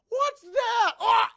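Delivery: high, loud mock alarm; hammed-up shriek of a movie victim; startled scream. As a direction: yell out as if suddenly attacked. Character attacked mid-break, acted.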